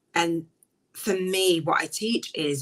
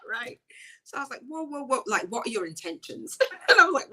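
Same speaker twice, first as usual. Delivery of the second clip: laughing; 10 semitones higher than usual. Real, not acted. Laughing over retold conflict.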